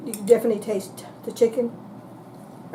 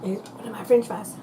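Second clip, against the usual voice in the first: normal talking voice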